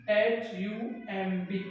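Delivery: ordinary delivery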